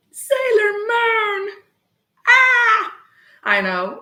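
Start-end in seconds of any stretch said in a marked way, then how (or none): 0.1-2.9 high, slow fangirl shriek; excited squeal. Real, not acted. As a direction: squeal it out loud with delight.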